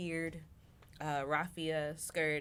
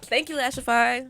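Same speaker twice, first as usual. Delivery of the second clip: mock shoutout; 7 semitones higher, 16 dB louder than usual. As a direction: hype it like an ad plug.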